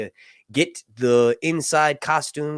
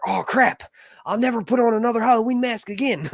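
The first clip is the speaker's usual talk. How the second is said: higher-pitched comic outburst; mock alarm; 10 semitones higher, 3 dB louder than usual. Reader retelling a scare to the co-host, mock alarm. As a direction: recreate a horrified reader's reaction, played for laughs. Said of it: "I remember reading that and being like".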